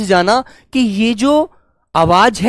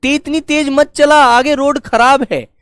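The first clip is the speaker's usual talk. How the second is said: louder and higher warning shout from afar; calling out; 5 semitones higher, 4 dB louder than usual. Retold or imitated. Teacher acting a warning shout to a friend on a bike. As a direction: yell to stop a friend speeding. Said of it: "आप उसको दूर से आवाज मारेंगे".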